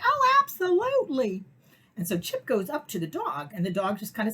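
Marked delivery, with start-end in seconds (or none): 0.0-1.4 much higher, louder cheerful yes; imitating the lady. Lady happily agreeing to the dog greeting, much higher. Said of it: "she's like".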